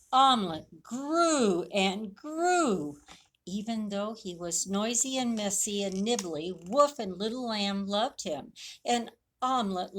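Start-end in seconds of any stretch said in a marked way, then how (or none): none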